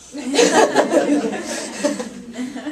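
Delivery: high burst of real laughter; 1 laughing. Real, not acted. Bride's sister cracks up during her speech, laughing. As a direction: break up laughing.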